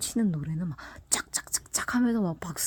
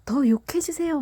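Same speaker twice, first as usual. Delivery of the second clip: high, louder mock begging from a thrilled fan; eager fan plea; 8 semitones higher, 4 dB louder than usual. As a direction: squeal it like a fan asking for more. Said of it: "막 이러면서". (신난 팬 흉내로 높게 조르듯)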